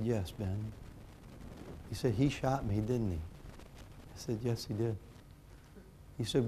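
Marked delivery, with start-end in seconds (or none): 0.0-5.0 soft, low, broken reply; a father quietly answering his hurt son; hushed answer. Retold or imitated. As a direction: answer gently, voice nearly gone.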